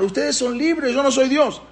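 high-pitched imitated proclamation; forced shouting. Paró made to declare the people free, high retold shout. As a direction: cry it out high for everyone. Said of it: "Y tuvo que gritar".

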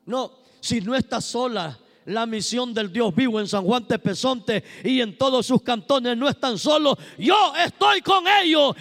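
reassuring divine voice building to a shout; God declaring he is with the prisoners, rising to a shout. Acted. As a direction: speak as God promising not to leave them. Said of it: "el Dios de los cielos dice".

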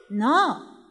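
normal talking voice

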